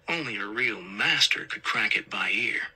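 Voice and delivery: ordinary delivery, nothing marked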